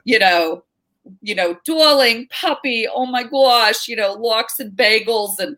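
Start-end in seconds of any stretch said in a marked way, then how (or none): 1.7-5.4 high, slow, fawning New York aunt voice. Retold or imitated. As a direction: gush it out like a doting aunt. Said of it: "very stereotypical".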